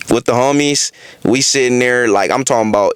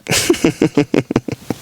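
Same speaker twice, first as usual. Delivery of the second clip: chuckle. Real, not acted. Laughing to viewers after saying it's not funny, chuckling. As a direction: let out a trailing laugh.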